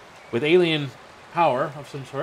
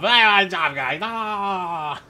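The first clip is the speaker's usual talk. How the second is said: higher, exaggerated talking-guy voice; hammed-up regular self; 5 semitones higher, 4 dB louder, much slower than usual. Shown as a demonstration. Streamer mocks his own regular voice, hammed up. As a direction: ham up an ordinary voice, pitched up. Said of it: "talk like my regular self".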